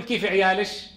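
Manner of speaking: "عيالش" ending in sh in place of k; كشكشة. Shown as a demonstration. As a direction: turn the last k of "عيالش" into sh. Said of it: "قلب الكاف شينا". (نطق "عيالش" بقلب الكاف شينًا)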